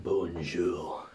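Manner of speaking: low, drawn-out mock French accent; put-on French accent. English tourist greeting the French, put-on French accent. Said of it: "I do it with the accent".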